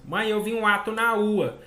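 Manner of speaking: put-on child says "ato" and "ua" with the initial r dropped; lightly voiced child, "ato na ua" with no r sounds; mild child voice dropping the r in "ato" and "ua"; child's mispronunciation. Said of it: "Na ua".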